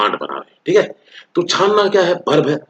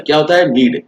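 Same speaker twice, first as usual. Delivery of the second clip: usual speaking voice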